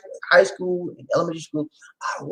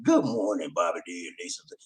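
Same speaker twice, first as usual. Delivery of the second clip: lower, softer and fast greeting; a hint of Lamont saying good morning; Lamont's voice; 12 semitones lower, 9 dB quieter than usual. Host quotes caller Lamont's morning greeting, lower and softer. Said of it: "I gotta do my Lamont voice".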